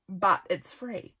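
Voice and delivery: ordinary talk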